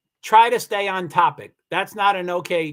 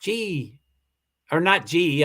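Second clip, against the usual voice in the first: normal talking voice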